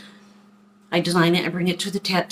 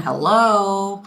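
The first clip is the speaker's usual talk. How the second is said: higher, drawn-up "hello?" of disbelief; mock obviousness; 4 semitones higher, 4 dB louder than usual. Daughter explaining crow tattoo to dad, mock obviousness. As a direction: play it like he should already know.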